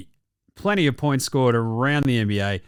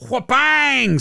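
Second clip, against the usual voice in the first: mock impact noise; 9 semitones higher than usual. Mimicking block.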